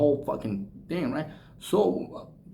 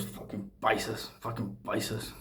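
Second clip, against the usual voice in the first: muttered insult; 5 semitones lower, 11 dB quieter than usual. Retold or imitated. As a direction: mutter it under your breath with contempt. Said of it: "he goes".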